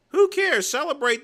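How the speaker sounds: high, loud dismissal; scoffing. Real, not acted. Streamer scoffing at viewers who want to cry about it. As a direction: toss it off with contempt.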